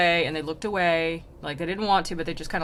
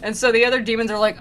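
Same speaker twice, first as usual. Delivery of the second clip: normal talking voice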